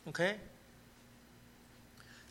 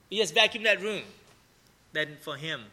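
usual speaking voice

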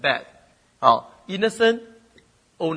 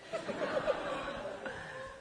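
high laugh; 8 dB quieter than usual. Real, not acted. Laughs at mom popping.

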